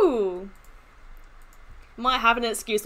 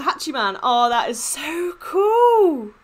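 ordinary delivery

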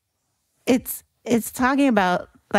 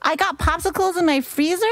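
incredulous quote; 8 semitones higher than usual. Retold or imitated. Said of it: "Like, what else is".

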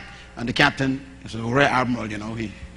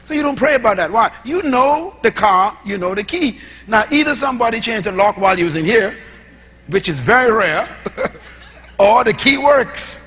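preaching at full force; 7 semitones higher, 6 dB louder than usual. Acted. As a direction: preach it to the congregation, punching every line. Preacher driving home a point.

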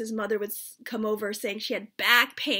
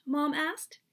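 ordinary delivery

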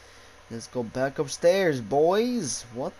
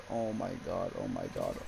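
ordinary talk, nothing marked

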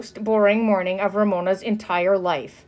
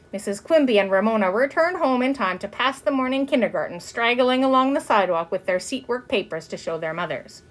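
plain reading voice

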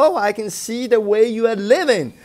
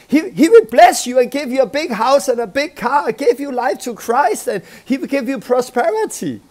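mock salesman preacher; 6 semitones higher, 4 dB louder than usual. Mimics a prosperity preacher.

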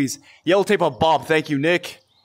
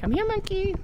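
high silly voice; 5 dB quieter than usual. Pretend. High put-on voice.

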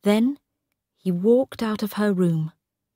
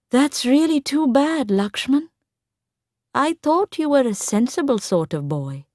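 calm disappointment; 7 semitones higher than usual. A narrator's reading. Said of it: "looked at him calmly".

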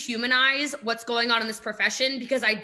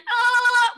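mock alarmed shout; 9 semitones higher, 7 dB louder than usual. Sound right after mock panic.

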